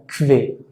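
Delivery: kve: q y u suenan como k y v; pronunciación alemana de qu. Shown as a demonstration. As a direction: di kve, con k y v, sin u. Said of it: "pronunciamos un sonido como si fuera una K y una V". (pronuncia kve como k más v)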